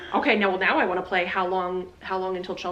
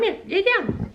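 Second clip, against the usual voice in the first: high-pitched sing-song command; high dog command; 13 semitones higher, slower than usual. Real, not acted.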